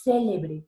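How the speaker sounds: CÉLEBRE punched on CÉ; first-syllable stress. Shown as a demonstration. Teacher showing an esdrújula, stress on CÉ. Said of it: "se volvió una palabra esdrújula".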